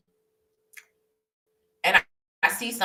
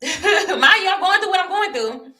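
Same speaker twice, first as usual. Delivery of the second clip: laughing; 10 semitones higher, 6 dB louder than usual. Real, not acted.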